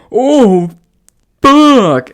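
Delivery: high, loud drawn-out mock groan; imitated moan. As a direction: belt the guy's climax groan. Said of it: "this is what I hear".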